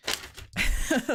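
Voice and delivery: airy unvoiced laugh; quick breathy chuckle. Real, not acted. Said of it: "sorry if you're hearing me".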